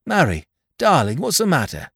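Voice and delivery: worried husband. Acted. Husband alarmed at wife.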